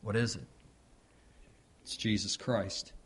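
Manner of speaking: ordinary delivery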